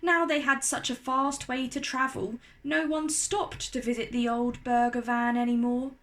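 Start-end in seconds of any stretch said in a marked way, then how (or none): none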